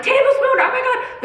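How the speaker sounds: very high mock panic; alarmed commenters. Comments flood in about the spoon, mock-horrified squeal. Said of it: "all of the comments were like".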